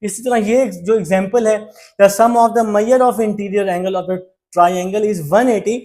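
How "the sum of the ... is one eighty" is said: slow dictation read. Shown as a demonstration. Teacher reads the theorem definition aloud for copying, slow and spaced. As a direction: dictate it word by word.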